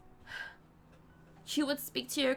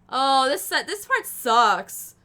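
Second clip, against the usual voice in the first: loud complaint; 11 dB louder, much slower than usual. Real, not acted. Reader complains loudly.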